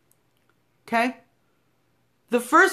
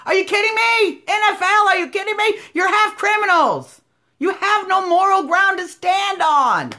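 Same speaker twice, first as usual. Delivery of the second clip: higher and louder, incredulous and scolding; indignant outrage; 8 semitones higher, 3 dB louder than usual. Real, not acted. To NFL athletes, an angry disbelieving rant. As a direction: let the disbelief boil over.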